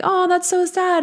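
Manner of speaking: high, cooing mock sympathy; imitated high-pitched pity; sympathetic listener. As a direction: coo pityingly in a high voice.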